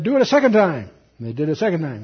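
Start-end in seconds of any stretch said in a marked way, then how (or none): 0.0-0.9 higher, raised-voice order; commanding. Acted. Elijah ordering more water poured, raised command. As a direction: give a firm command. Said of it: "And he said".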